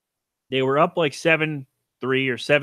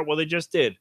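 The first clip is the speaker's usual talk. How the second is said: ordinary talk, nothing marked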